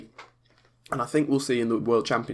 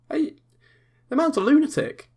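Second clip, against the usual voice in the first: exclaiming; 4 dB louder than usual. Real, not acted. Exclaims about Ronnie fondly.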